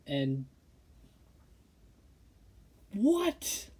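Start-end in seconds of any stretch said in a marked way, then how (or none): 2.9-3.7 disbelieving what. Real, not acted. Reading the fans' letter.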